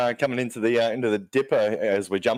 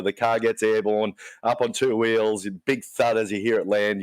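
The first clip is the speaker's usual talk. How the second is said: ordinary talk, nothing marked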